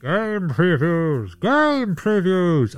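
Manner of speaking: long drawn-out mock announcer call; slow, theatrical character voice; inadvertent Bane. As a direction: stretch the words out in a put-on voice. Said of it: "Inadvertent Bane".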